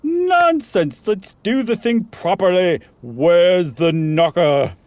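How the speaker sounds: scoff, then drag out the last question; Meagle's voice. Acted. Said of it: "said Meagle".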